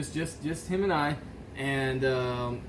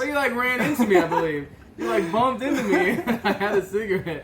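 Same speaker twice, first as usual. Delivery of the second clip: laughing; 5 semitones higher, 4 dB louder than usual. Real, not acted. Laughing while recalling the burn.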